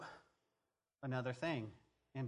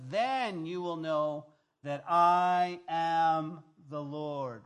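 slow, loud and drawn out, the voice of God; booming proclamation; 9 dB louder, much slower than usual. Acted. Preacher booming God's promise to the bones, slow and loud. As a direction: stretch it out and let it ring like thunder.

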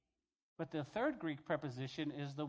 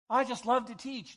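higher and louder gushing; mock eager teacher; 7 semitones higher, 8 dB louder than usual. Guys who want to teach, higher, louder mock gushing. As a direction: gush like an eager young guy.

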